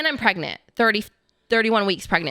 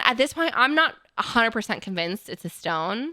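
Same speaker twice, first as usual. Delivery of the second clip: ordinary delivery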